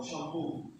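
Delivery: shampoo said softly, weight on POO; apply the rule to "shampoo", landing on the final syllable; quiet sham-POO in shampoo; stress on last syllable. Shown as a demonstration. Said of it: "This stress on this last syllable".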